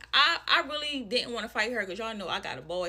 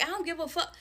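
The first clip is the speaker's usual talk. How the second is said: imitated brash mother; 7 semitones higher than usual.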